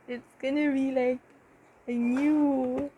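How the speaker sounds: normal talking voice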